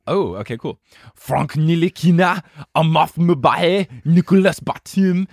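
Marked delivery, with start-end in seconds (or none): 1.2-5.3 loud, hammed-up French accent; French accent, projected like a roll call. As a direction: announce the names in a big French accent. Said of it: "read it with a French accent".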